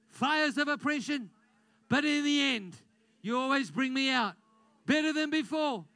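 loud, high proclaiming declaration; fervent preacher leading a declaration; full-force preaching. Acted. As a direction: shout it out with conviction.

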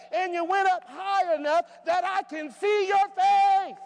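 loud, high preaching that keeps climbing; full-force preaching. Acted. Preacher to his congregation, loud and rising. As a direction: preach it out to the whole church.

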